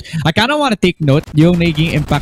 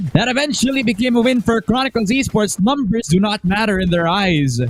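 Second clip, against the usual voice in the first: esports caster; 7 semitones higher than usual. Acted. Live play-by-play.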